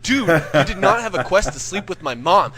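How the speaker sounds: loud, high emphatic protest; indignant. Acted. Protesting Jimmy's quest claim, loud indignant argument. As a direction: argue back in loud outrage.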